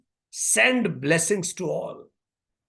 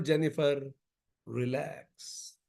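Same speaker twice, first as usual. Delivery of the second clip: much softer, lower, trailing into near murmur; hushed, soothing reminder to oneself; retold self-soothing; 4 semitones lower, 10 dB quieter, slower than usual. Speaker as Jennifer telling herself, hushed calming. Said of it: "So I am telling myself".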